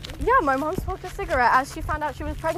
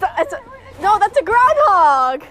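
loud, slow, thrilled announcement; excited; 7 semitones higher, 7 dB louder, slower than usual. Real, not acted. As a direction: call out the find with delight. Spotting a groundhog.

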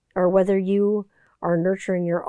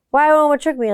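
put-on upset kid; 7 semitones higher, 6 dB louder, faster than usual. Son upset about Oma's book.

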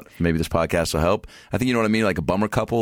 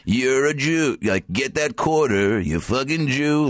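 slow, higher cartoon villain voice; Grinch; 7 semitones higher, much slower than usual. Inner voice goading comic toward the quarter, mock villain. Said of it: "like, Grinch voice".

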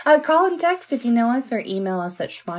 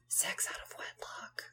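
whispering; 22 dB quieter than usual. Real, not acted. Scandalous detail whispered.

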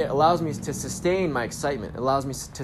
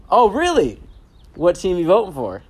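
bright, much higher and louder "Oh, really?"; playful; 6 semitones higher, 9 dB louder than usual. Retold or imitated. Asking the cashier about the Super Bowl, playful and lively. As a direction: tease her out of her thought loop. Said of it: "playful energy".

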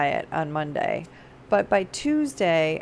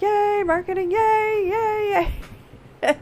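much higher, louder cheering; mock cheering; 13 semitones higher, 4 dB louder, slower than usual. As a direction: cheer like a hyped crowd. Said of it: "started cheering".

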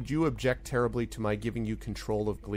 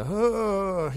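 weary, high, sagging cheer; half-hearted ragged cheer; 10 semitones higher, 4 dB louder than usual. Acted. Rescued villagers in bad shape emerge, cheering half-heartedly. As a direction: cheer feebly, worn out. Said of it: "half-hearted, ragged cheer".

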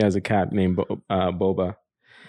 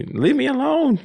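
much higher squeaky cat voice; voicing the cat. Host voicing the cat Boba in a very high squeak. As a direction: play the grumpy cat talking back. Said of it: "She's like".

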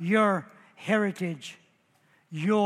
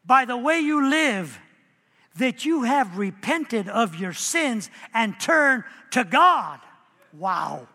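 ordinary talk, nothing marked

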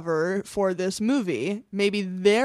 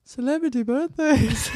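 put-on Liverpool accent through a laugh, pitched higher; 3 semitones higher than usual. Cohost tries the Beatles Liverpool voice while laughing. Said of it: "but with the Beatles sound again".

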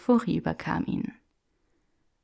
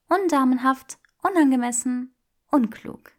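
put-on higher, playful young woman; teasing Hermione voice; 7 semitones higher, 3 dB louder than usual. Granger teasing Draco in the bookshop, playful and higher. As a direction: tease him with mock refusal.

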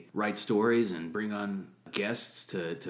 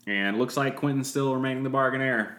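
announcer voice; 4 semitones higher, 4 dB louder than usual. Pretend. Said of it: "I don't know why Donna put on that voice, and now I have as well".

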